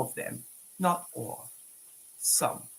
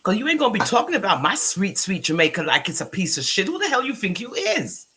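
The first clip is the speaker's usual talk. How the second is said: louder, heated scolding; real outrage at the slight; indignant rant; 2 semitones higher, 5 dB louder than usual. Real, not acted. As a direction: tear into her for insulting Jamaica. Ranting at a woman online.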